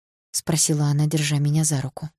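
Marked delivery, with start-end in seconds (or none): none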